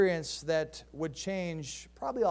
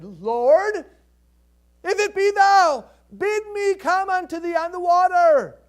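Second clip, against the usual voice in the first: high, loud call across the water; Peter calling out; 11 semitones higher, 13 dB louder, slower than usual. Acted. Peter calling to Jesus on the sea, loud call. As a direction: shout it out over the waves.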